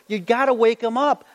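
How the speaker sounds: high, teasing jeer at the prophets; mock them, enjoying it; mocking taunt. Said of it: "Elijah is really starting to have fun".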